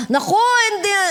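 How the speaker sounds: high, dismissive Naku, hindi; mock protest. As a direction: brush it off in a mock voice. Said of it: "Para, para matetem ka". (kunwaring pagtanggi)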